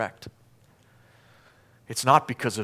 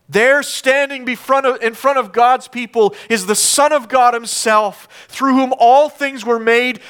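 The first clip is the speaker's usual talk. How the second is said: raised, higher preacher's voice; forceful preaching; 9 semitones higher, 7 dB louder than usual. Real, not acted. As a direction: preach it with rising indignation.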